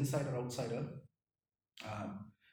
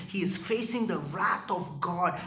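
normal talking voice

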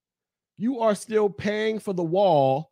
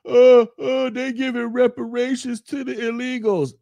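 mocking a whiny complainer, pitched higher and louder; mock whiny complaint; 7 semitones higher, 4 dB louder than usual. Mocking YouTubers who cry about reparations, higher, whiny. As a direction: whine it like a crybaby. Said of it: "Yvette Carnell and Tone Talks crying about".

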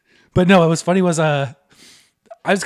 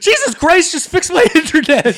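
much higher, loud exasperation; exasperated outburst; 9 semitones higher, 5 dB louder than usual. Acted. Fed-up caller ranting at tech support, high and loud. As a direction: blow up at the call.

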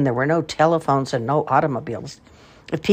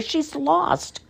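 pitying woman; 13 semitones higher than usual. A narrator's reading. Woman comforting lost girl.

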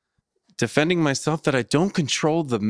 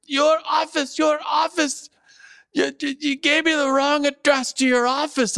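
very high mock shouting; hammed-up high-pitched accusation; imitated screaming tenant. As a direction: screech it like an irate caller who repeats herself. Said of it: "the top of her lungs".

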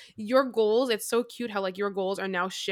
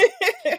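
a sudden high burst of laughter; loud high laugh; 9 dB louder than usual. Real, not acted. Laughing hard at how she once wrote.

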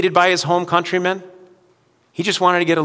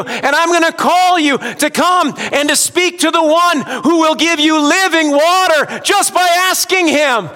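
raised, ringing preacher's voice, pressing on; full-force preaching; 12 semitones higher, 7 dB louder than usual. Real, not acted. Pastor urging the church toward broken people, preaching hard. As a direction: preach it out high and loud to the room.

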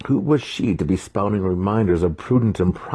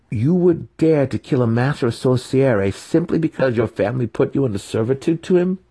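higher, louder disbelief; incredulous; 8 semitones higher, 5 dB louder than usual. A narrator's reading. Trapped wizard confronts the apprentice, incredulous. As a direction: demand an answer.

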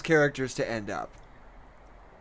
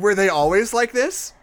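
laughing; 5 semitones higher, 4 dB louder than usual. Pretend. Voicing the line through laughter.

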